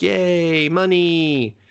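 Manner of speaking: high, loud, drawn-out cheer; mock cheering. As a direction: imitate gleeful townsfolk cheering. Acting out townsfolk cheering for money.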